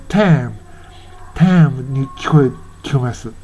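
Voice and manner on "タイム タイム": タイム with "ai" flattened toward "eh"; widened "ai" vowel. Shown as a demonstration. Teacher showing the same vowel change in the song, open "eh". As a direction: say タイム like a drawn "tehm". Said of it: "このタイムも同じで".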